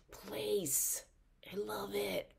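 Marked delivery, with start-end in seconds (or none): 1.5-2.3 whisper. Real, not acted. Fond aside.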